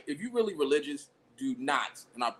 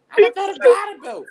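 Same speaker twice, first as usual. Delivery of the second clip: much higher, loud mock call-out; mock shout; 12 dB louder than usual. Mocking the garter toss at the wedding, loud and high. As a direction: yell it like a hype man at the toss.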